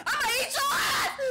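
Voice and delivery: shrieking threat. Real, not acted. Taunting chasing enemy.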